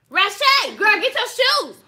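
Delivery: calling out loud. Pretend. A mother yelling for a child named Ratchet, loud call. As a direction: holler for a kid named Ratchet.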